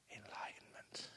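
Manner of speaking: breathy hushed whisper; whispered word. Shown as a demonstration. Naming the final stage to students in a whisper. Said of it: "whispered final stage".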